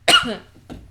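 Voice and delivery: sudden hard cough on a drink; choking cough. Real, not acted. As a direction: cough as if something went down wrong.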